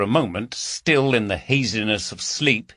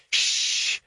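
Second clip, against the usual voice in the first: hushing. Acted. Hushing caller, partner asleep.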